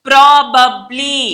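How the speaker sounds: PROBABLY very slow, pro-bab-ly with both Bs; syllable-by-syllable model of PROBABLY; every B sounded. Shown as a demonstration. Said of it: "అన్ని పలుకుతాం మనం".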